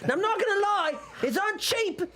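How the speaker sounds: fast-talking, lively shopkeeper patter; a pushy trader sizing up customers; haggling merchant. Acted. Game master voices haggling merchant.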